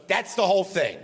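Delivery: high mock declaration; Mel Brooks. Mel wrapping up his story, mock emphasis. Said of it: "And he goes".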